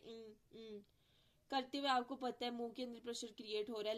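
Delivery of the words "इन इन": इन said softly twice, the ending held in the nose; ing sound demo. Shown as a demonstration. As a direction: breathe इन out as a soft nasal ng. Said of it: "इन produce करें".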